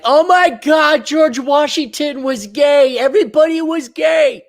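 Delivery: high, loud, drawn-out mock outcry; over-the-top astonished shout; mock shock. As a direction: exclaim like a scandalized gossip, hammed up.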